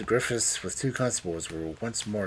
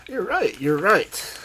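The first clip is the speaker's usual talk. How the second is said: high, loud, excited cry; excited agreement; 12 semitones higher, 8 dB louder, slower than usual. A narrator's reading. Old man seizing her hand, excited. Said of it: "springing up excitedly from his chair".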